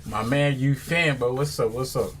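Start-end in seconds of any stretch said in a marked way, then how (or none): none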